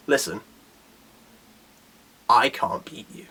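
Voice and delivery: low, quiet, measured confession; level with your opponent softly, pausing between phrases; sober admission. Acted. Fighter's sober admission, acted out.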